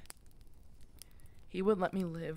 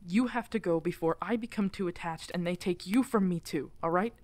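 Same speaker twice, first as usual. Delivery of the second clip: louder, desperate insistence that they must go; urgent pleading; 5 dB louder than usual. Acted. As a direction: push them away out of love. Pleading the listener to leave.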